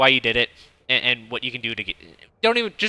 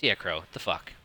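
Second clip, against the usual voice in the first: lower, softer inner voice muttering; a quieter inside voice, half to himself; 7 semitones lower, 10 dB quieter than usual. Retold or imitated. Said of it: "My other, my other inside voice says".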